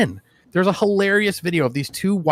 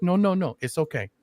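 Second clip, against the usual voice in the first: quiet, soothing mock comfort; soft reassurance; 6 dB quieter than usual. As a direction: hush him like a scared friend. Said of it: "He's like".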